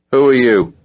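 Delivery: loud, high challenge; commanding. A narrator's reading. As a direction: bark the question at a stranger. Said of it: "Alan's commanding voice".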